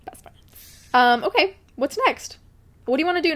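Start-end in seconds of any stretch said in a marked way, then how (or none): 0.0-0.4 a soft whispered echo. Real, not acted. Echoing her friend's claim in a whisper. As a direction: breathe it like a secret.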